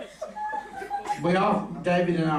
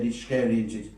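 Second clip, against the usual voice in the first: ordinary talk, nothing marked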